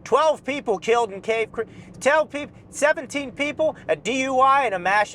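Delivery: breathless bad-news headline; mock news anchor. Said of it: "They're like".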